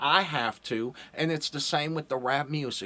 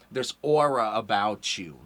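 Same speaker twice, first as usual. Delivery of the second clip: ordinary talk, nothing marked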